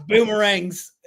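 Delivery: playful drawn-out Australian; Australian accent. Shown as a demonstration. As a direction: draw it out in a jokey Aussie accent. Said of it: "I might be a little Australian".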